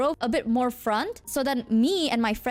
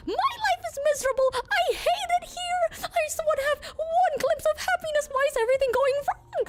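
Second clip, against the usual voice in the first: hammed-up squealing meltdown, very high; highest-pitched voice; faster than usual. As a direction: lament in an absurdly high voice. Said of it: "In, like, a, the highest pitched voice".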